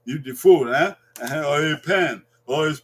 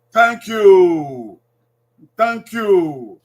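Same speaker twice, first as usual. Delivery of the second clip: loud modelled thanks; 2 semitones higher, 8 dB louder, much slower than usual. Shown as a demonstration. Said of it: "teacher models phrase loudly".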